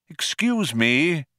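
slow, high, polite 'excuse me'; polite interruption. A narrator's reading. Old man interrupts the diners, politely. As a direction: break in politely, drawing it out. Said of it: "coughed politely".

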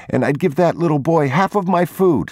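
put-on a little boy begging his father to rescue another child; pleading boy. A narrator's reading. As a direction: plead with Papa like a young boy.

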